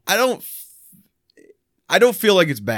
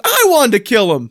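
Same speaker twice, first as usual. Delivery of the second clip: higher, louder imitated complaint; mock protesting kid; 9 semitones higher, 5 dB louder than usual. Turkey's young owner wanted to do it himself, loud high mock protest. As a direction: act out a child's protest, pitched up and louder. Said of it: "He goes".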